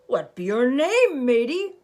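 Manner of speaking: pirate captain asking. Acted. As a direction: boom it down at the boy. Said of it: "the head pirate asked".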